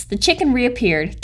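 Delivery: plain reading voice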